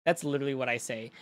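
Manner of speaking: usual speaking voice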